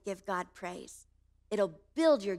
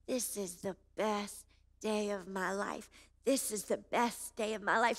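heartfelt inner recital; 5 dB quieter than usual. Retold or imitated. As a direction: say it softly to yourself, savoring it. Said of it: "my heart started to recite those words".

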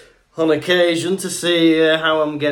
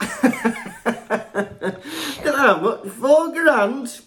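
mock shock; 11 semitones higher, slower than usual. Reacting to imagined statement.

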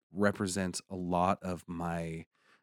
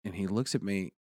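ordinary delivery

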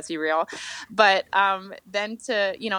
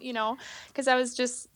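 ordinary delivery

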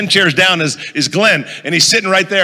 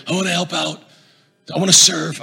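softly voiced tough guy; hushed mobster impression; intimidating mobster; 4 dB quieter than usual. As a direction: lightly imitate an intimidating mobster. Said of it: "this is exactly how he sounds".

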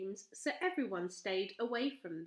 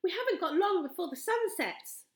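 put-on urgent girl; 10 semitones higher, 7 dB louder than usual. A narrator's reading. Urgent girl flying home.

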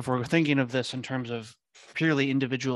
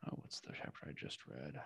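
whispered to himself, much softer; muttered aside; 9 semitones lower, 17 dB quieter than usual. Real, not acted. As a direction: mumble it under your breath while searching memory. Muttering to himself mid-talk.

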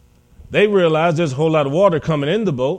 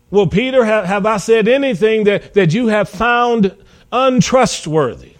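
louder, higher, insistent questioning; pressing challenge; 5 semitones higher, 4 dB louder than usual. Acted. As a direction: press him hard for an answer. Jesus voiced questioning his disciple.

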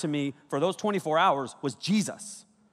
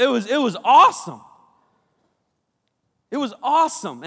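excited; 9 semitones higher, 11 dB louder than usual. Real, not acted. Excited recounting.

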